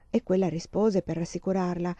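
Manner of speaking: ordinary delivery, nothing marked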